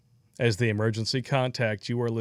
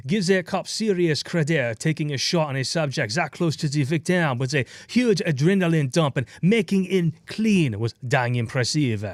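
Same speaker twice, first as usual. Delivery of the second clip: bad French accent; 3 semitones higher than usual. Host reads a donation message in a bad French accent. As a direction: read it in a mock French accent. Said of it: "My terrible French accent".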